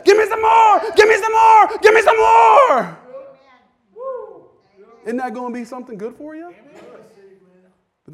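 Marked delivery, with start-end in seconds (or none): 0.0-3.0 full shout, high and loud, slowing at the end; shouted demand. Acted. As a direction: shout it to the rafters. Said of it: "you're gonna say".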